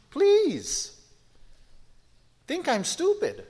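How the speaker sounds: high-pitched, exaggerated brush-off; dismissive scoff. Real, not acted. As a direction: wave it off with a swooping high voice. Preacher scoffs at being thought stupid.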